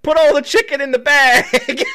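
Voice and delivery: laughing through a mock robber's order; half-laughing gruff demand; giggling imitation of the gunman.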